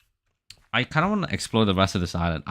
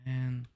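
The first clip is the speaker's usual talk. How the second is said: weary sigh; 5 semitones higher, 7 dB quieter than usual. Real, not acted. Frustrated at the game.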